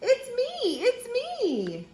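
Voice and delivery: very high singsong voice; horse character. A narrator's reading. Horse revealing who is under the mask, high singsong. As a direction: sing out each "me" up high. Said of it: "says the horse".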